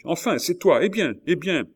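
crié plus haut et plus fort; cri impatient. A narrator's reading. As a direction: appeler avec impatience depuis la cour. Le duc appelle son homme. (le duc crie vers le balcon, impatient)

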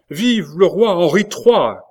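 high, loud, slow acclamation; acclaiming cry. A narrator's reading. Queen mother hails her son as king, crying out. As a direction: hail the new king at full voice. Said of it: "cria alors Catherine".

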